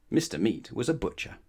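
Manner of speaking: usual speaking voice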